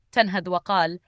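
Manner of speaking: ordinary delivery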